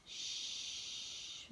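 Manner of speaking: "sh" hissed softly without voice; sh sound. Shown as a demonstration. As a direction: hold a long quiet "sh". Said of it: "add a sh at the beginning".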